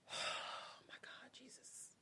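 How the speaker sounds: breathy whisper, slow; whispered disbelief. Real, not acted. Teller reacting to the story, whispered disbelief. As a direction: mutter it under breath, stunned. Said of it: "reacting in disbelief".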